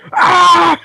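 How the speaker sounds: high loud scream from afar; shout. Retold or imitated. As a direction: yell as if dragged away. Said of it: "as they drag away out of earshot".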